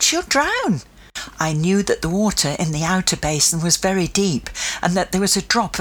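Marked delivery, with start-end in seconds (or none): 0.0-0.8 high, dismayed exclamation; alarmed thought. A narrator's reading. Child fears for the pet at the dockside, alarmed thought. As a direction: voice lifted high with dread.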